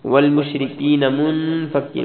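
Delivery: منفک with the نون nasalised; nasal ghunna demo. Shown as a demonstration. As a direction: hum the ن in منفک slowly. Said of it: "من بغنہ ہوگا". (منفک میں نون غنہ کے ساتھ)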